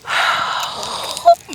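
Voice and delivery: long, high breathy sigh of despair; heavy sigh. Real, not acted. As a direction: sigh out the heartbreak. Missed bald eagle, heartbroken.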